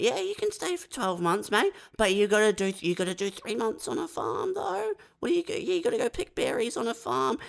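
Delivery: breezy official brushing off backpackers; casual Aussie official. Retold or imitated. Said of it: "it'd be like".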